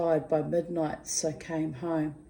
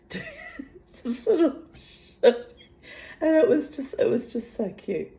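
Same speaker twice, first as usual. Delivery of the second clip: high, laughing squeal; 11 semitones higher, 4 dB louder than usual. Real, not acted. Recalling her flirting friend, laughing high. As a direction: giggle through it, much higher.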